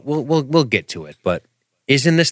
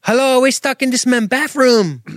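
high pleading voice; 3 dB louder than usual. Pretend. Voicing trapped person on phone.

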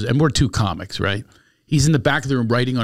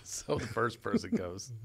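ordinary talk, nothing marked

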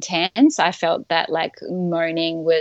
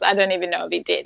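normal talking voice

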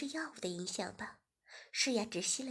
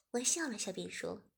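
plain reading voice

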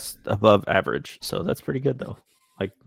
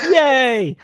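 excited cheer; 13 semitones higher, 4 dB louder than usual. Real, not acted. Player cheers at the table.

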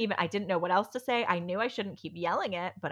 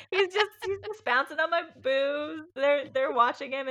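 laughing; 8 semitones higher, 5 dB louder than usual. Real, not acted. Laughing at the memory.

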